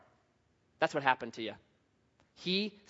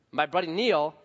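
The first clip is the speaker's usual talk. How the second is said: ordinary talk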